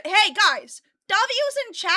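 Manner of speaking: loud, high call to the chat. Real, not acted. Host shouting out to the chat about the apology. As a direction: hype the viewers up.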